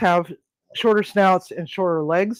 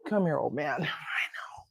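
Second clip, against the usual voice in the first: whispered, soothing; soft whisper; 10 dB quieter than usual. Real, not acted. Soothing her old cat, whispered close. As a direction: hush him gently as you pick him up.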